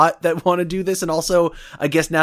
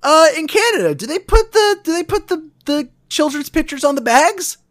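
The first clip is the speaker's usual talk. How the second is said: silly high voice; 12 semitones higher than usual. Acted. High pitched silly question.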